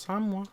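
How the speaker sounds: "Samoa" as SAHM-wah; two-syllable Samoa. Shown as a demonstration. Doubting friend's pronunciation, "Samoa" as SAHM-wah. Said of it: "I've never heard it pronounced".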